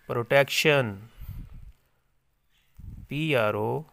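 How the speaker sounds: normal talking voice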